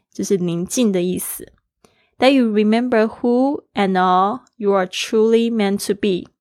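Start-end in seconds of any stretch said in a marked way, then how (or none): none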